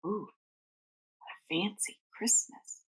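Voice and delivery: usual speaking voice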